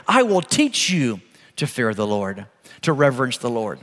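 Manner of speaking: gentle quote. Acted. Gentle scripture quote to children.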